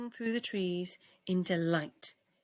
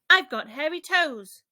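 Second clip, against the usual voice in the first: cheerful boast; 6 semitones higher, 5 dB louder than usual. A narrator's reading. Ike repeats his cheerful boast.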